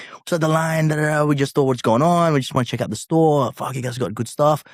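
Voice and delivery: a hint of an American accent from the band. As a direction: imitate the American visitors chatting. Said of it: "They have an American accent".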